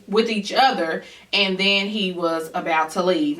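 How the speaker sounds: ordinary talk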